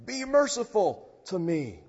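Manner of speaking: firm commanding cry; commanding plea. Shown as a demonstration. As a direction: demand it like a beggar commanding the Lord. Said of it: "poor beggar is commanding the Lord of life".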